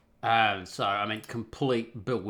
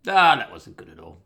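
Ah, that wasn't good at all, burst out louder; louder exclamation; 13 dB louder than usual. Real, not acted. Telling viewers he woke at night, loud exclaim. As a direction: hit it loud with a groan-like Ah.

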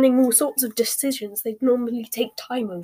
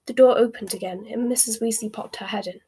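ordinary delivery, nothing marked

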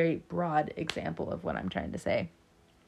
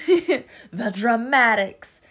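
loud, drawn-out dramatic flourish; playful dramatics; 3 semitones higher, 9 dB louder, much slower than usual. Pretend. Listing fire sign passions to viewers, playfully dramatic. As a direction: laugh into a hammed-up proclamation.

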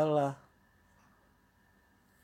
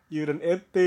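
raised, louder doorstep call; collector's call; 5 semitones higher, 6 dB louder than usual. Acted. Joking fee collector at the door, calling out. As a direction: call out like someone collecting dues.

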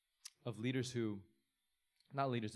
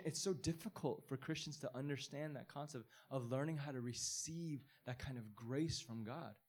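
normal talking voice

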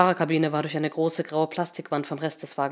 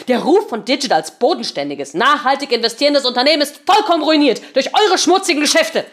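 angry; 7 semitones higher, 7 dB louder than usual. Acted. Angry accusation.

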